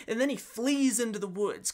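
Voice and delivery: ordinary delivery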